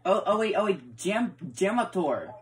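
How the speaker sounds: loud, high, sudden excited call; excited shout. Real, not acted. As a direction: call out his name the moment you spot him. Suddenly spots Jamator arriving.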